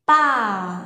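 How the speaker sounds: "Pa" loud and high; high level tone. Shown as a demonstration. Teacher demonstrates the high level tone again. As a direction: hit "Pa" high, straight and strong. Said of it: "cao nó thẳng".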